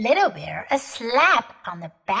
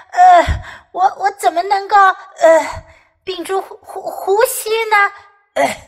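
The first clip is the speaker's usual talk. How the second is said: hiccuping; 9 semitones higher, 5 dB louder than usual. Acted. Bear speaks with hiccups.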